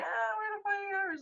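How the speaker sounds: high, fast cheerleader shout; mock cheer. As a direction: ham up the school cheer. Mimicking a cheer.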